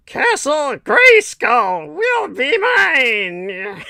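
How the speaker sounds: slow, high, loud villain voice; Skeletor voice. Retold or imitated. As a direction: drag it out like a cartoon villain. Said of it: "what was it he always said?".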